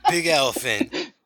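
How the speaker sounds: high and loud through laughter; cracking up mid-word; laughing. Real, not acted. Joking about Eddie Murphy elephant.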